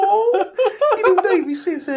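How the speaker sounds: put-on high teasing baby voice, laughing; baby talk. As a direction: coo it like mocking a baby.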